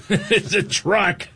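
high-pitched, very slow playful protest; hammed-up parent insisting; mock exasperated correction. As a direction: squeal the correction slowly, half laughing. Dad repeating the correction, laughing.

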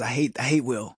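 low, soft buddy voice; low soft voicing of a friend; friend's voice. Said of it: "He goes".

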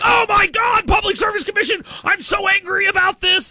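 high-pitched, hammed-up angry voter shouting; mock fired-up outrage. Radio host mimicking agitated Democratic voters, mock fired-up outrage. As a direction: imitate worked-up voters in an exaggerated high yell. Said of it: "they get all fired up about this".

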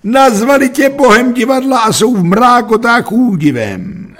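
high, loud theatrical proclamation; triumphant cry. A narrator's reading. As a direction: proclaim it at a soaring pitch. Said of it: "vykřikl jsem".

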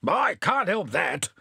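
slow, mildly angry retort; a touch of hammed-up anger; angrily. A narrator's reading. Mayor snapping at the constable, mildly angry. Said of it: "cried the mayor angrily".

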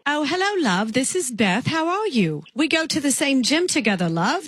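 lightly put-on English accent calling a stranger "love"; sweet English caller, accent worn lightly; British accent. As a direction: play a chatty Englishwoman with a mild accent. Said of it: "I'm from England".

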